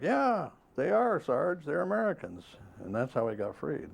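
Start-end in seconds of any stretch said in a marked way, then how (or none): 0.0-2.4 retold yelling back. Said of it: "they started yelling back".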